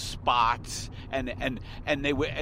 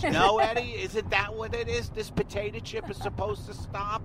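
mock ad director; 8 semitones higher, 4 dB louder than usual. Mocking ad director voice.

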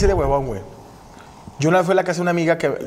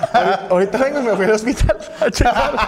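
higher-pitched, laughing as he talks; laughing through words; 6 semitones higher than usual. Real, not acted. Guest jokes about his own health scare, laughing. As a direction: crack up while telling it. Cracking up while finishing the story.

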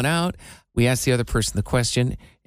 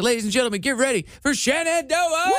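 stage announcer; 10 semitones higher than usual. Retold or imitated. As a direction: hype up the crowd like a stage announcer. Introducing artist on stage.

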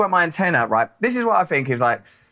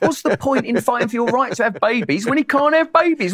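quoting Reg from Life of Brian; 7 semitones higher than usual. Retold or imitated. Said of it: "there's one where he".

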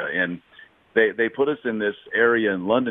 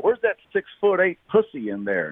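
raised, taunting barroom voice; mock heckler; 8 semitones higher than usual. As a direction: jeer it like a drunk heckler. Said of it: "And I said".

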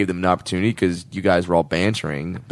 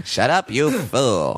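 Lovitz impression; 2 semitones higher, slower than usual. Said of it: "one and a half impressions".

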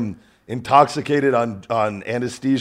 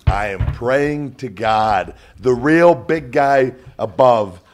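slow earnest; 4 dB louder, slower than usual. Real, not acted. Slow earnest prayer aside.

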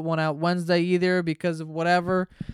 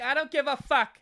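mom voice; 9 semitones higher than usual. Retold or imitated. Mom's blunt reply in story.

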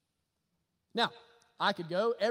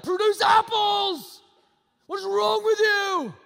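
slow, drawn-out furious shout, much higher and louder; yelling at a branch; 12 semitones higher, 13 dB louder, much slower than usual. Pretend. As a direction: scream at the dead branch in frustration. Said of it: "I'm gonna start yelling at it".

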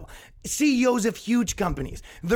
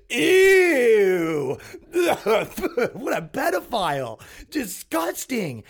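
louder, laughing "Ew" of mock revulsion; mock disgust; 3 dB louder, much slower than usual. Host mocking outraged online crowd, mock disgust. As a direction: sneer it with exaggerated disgust.

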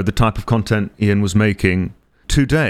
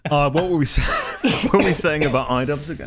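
laughing; 3 semitones higher than usual. Real, not acted. Still laughing after the joke.

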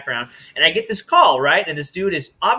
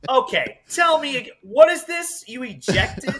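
high, loud, puzzled questions; surprised dispatcher; 10 semitones higher, 4 dB louder than usual. Retold or imitated. Dispatcher asks the caller, high and loud, baffled. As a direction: act baffled by the news, pitch up.

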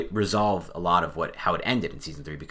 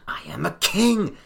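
put-on high, slow bratty boy king; a lightly voiced bratty boy king; Joffrey impression; 9 semitones higher, slower than usual. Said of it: "that was a horrendous Joffrey impression".

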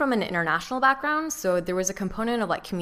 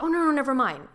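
higher, hurried take-back; quick flustered take-back; stressed mentor; 8 semitones higher, 4 dB louder than usual. Retold or imitated.

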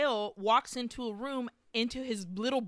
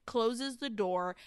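normal talking voice

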